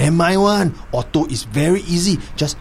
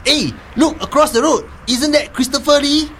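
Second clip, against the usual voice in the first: excited; 8 semitones higher than usual. Acted. Distracting the police officer.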